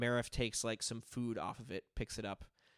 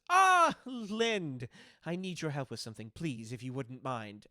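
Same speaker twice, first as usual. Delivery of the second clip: lightly voiced hostess; 2 semitones higher, 7 dB louder than usual. GM voices Franny calling Lind.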